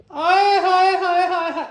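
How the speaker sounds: high, slow, drawn-out ooh of delight; slow high hammed-up rapture; swooning delight. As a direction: gush over the money like a treasure. Swooning over fresh notes.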